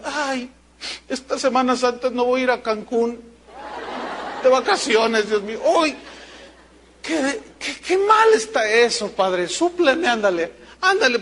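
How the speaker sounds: high, hammed-up whine with a laugh in it; mock whining churchgoer. Pastor mocking a churchgoer who misses Cancún, hammed-up whine. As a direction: whine like a spoiled believer begging God.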